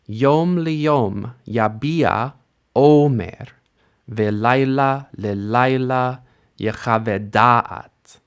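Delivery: slow, measured recitation of the verse; unhurried liturgical reading; slow Hebrew reading. Shown as a demonstration. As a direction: read it slowly for students to follow. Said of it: "I'm going to read it slowly to you".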